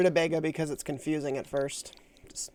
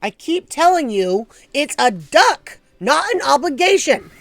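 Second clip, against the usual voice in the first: slow, louder, rising exasperation; mock mad; 8 semitones higher, 7 dB louder, slower than usual. Streamer replaying a quote, sounding mock mad. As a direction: insist like you've said it a hundred times. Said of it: "I don't know why I sound so mad".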